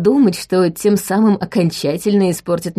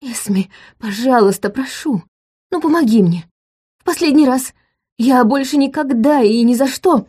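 high, desperate begging; 5 semitones higher than usual. A narrator's reading. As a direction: beg her for one last favour. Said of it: "умоляюще сложил на груди руки".

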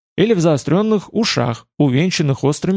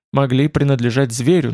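ordinary delivery

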